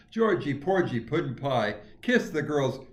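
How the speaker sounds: loud, punchy mocking rhyme; bully's taunt. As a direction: throw the rhyme at a kid as a jeer. Bully repeats his taunt.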